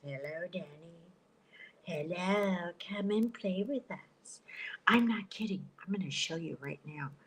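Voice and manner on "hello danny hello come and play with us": lightly voiced creepy twin girls, softer and lower; a hint of the Shining twins luring Danny. Imitating the creepy twins calling Danny, lightly voiced. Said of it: "looking for two little twin girls".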